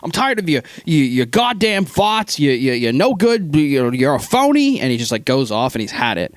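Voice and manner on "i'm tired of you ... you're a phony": mimicking the old keeper's drunken tirade; old sailor rant. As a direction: spit it out like a fed-up old sailor. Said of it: "this is, like, when he does his".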